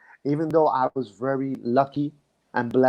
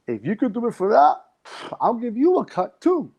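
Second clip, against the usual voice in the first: retold eager yes, voiced much higher; lightly voiced mentor, pitched up high and keen; mentor's eager reply; 10 semitones higher than usual. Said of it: "He goes".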